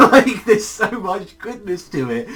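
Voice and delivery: high, loud, laughing through the words. Real, not acted. Reviewer gushing about the episode, laughing loudly. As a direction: laugh out your delight.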